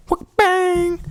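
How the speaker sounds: high sharp whoop and bang like a home run swing; mock bat crack. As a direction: cry out the hit in a high yelp. Sound of bat hitting ball.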